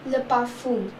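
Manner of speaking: le parfum said luh par-FUN with a nasal end; le parfum, the m not sounded; French le parfum. Shown as a demonstration. Teacher giving the French word for perfume. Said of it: "And then we have perfume".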